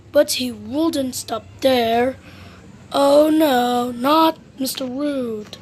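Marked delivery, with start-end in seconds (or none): none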